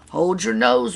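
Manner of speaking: high, loud story wail; wailing crowd. A narrator's reading. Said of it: "wailed the cry".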